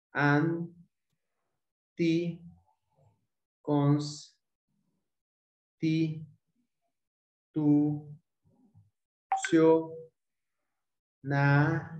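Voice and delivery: "Anticonstitucionalidad" very slowly, a long pause after each syllable. Shown as a demonstration. Teacher separating a word for students, "Anticonstitucionalidad" syllable by syllable. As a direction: break "Anticonstitucionalidad" into separate, evenly spaced syllables. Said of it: "se va a separar de la siguiente manera".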